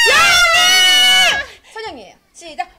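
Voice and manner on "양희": long loud high shout; winner shout. Real, not acted. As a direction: stretch the name out loud. Wheel stops on winner.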